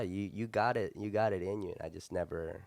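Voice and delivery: ordinary talk, nothing marked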